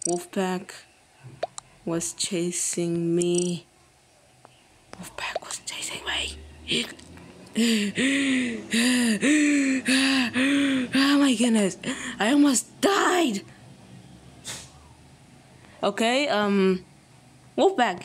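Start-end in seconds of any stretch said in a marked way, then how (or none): 4.9-6.4 whispering. Real, not acted. Whispered after chase. 7.5-10.9 relieved gasp. Real, not acted. After near death. 11.0-13.4 relieved. Real, not acted. Relief after narrow escape.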